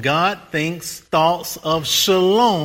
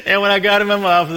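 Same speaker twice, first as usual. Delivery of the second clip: laughing through words; 3 semitones higher, 4 dB louder, much faster than usual. Real, not acted. Still laughing.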